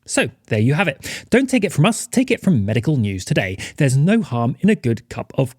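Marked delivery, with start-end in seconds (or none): none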